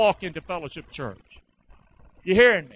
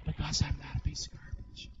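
whisper; 3 semitones lower, 11 dB quieter than usual. Real, not acted. Preacher drops to a whisper.

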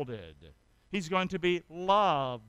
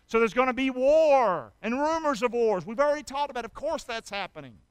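raised, louder preacher's call; fiery preaching; 5 semitones higher, 7 dB louder than usual. Acted. Pastor warning his church, fiery preaching. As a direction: drive it home to the pews.

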